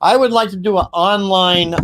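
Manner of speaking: usual speaking voice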